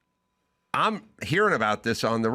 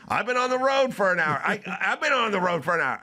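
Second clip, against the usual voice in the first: fast, high imitated excuse; defensive protest; 8 semitones higher, faster than usual. Friend Mike excuses himself after being scolded, defensive. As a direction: protest in a high rush, repeating yourself.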